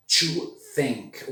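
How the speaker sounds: "think" with a breathy TH; "To think" with the tongue between the teeth; unvoiced TH. Shown as a demonstration. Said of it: "Outra forma de falar o T H".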